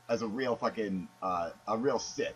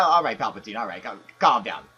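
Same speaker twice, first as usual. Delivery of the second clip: loud calming; 8 dB louder than usual. Acted. Host calms the caller.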